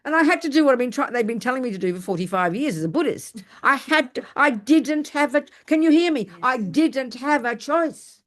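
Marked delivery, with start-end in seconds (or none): 3.6-8.2 raised pitch, each word punched; high, deliberate, drawn-out emphasis; emphatic insistence. Real, not acted. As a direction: hammer it home slowly, word by word.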